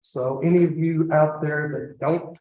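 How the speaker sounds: normal talking voice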